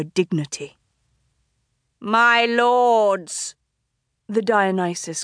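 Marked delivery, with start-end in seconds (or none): 2.0-3.5 theatrical host. A narrator's reading. Dionysus addresses masked crowd.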